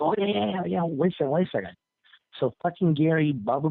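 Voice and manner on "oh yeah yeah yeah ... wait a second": high, eager imitation of an intern; suddenly eager. Intern hears of the stunt, suddenly eager. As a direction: perk right up, don't let the caller go.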